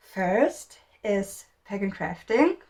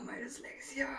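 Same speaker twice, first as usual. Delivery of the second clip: breathy under-the-breath whisper, much softer; whispered aside; 5 semitones higher, 14 dB quieter than usual. Real, not acted. Whispering to herself while stumbling over a name. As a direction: mutter it to yourself, embarrassed.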